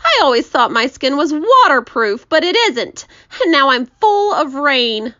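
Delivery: high, loud lament; hammed-up aggrieved moan; groaning complaint. A narrator's reading. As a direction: wail it like a miserable earthworm. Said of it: "the earthworm groaned".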